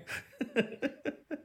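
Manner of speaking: soft, high chuckle; laughing. Real, not acted. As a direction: giggle softly at the joke. Cracks up at polar bear joke.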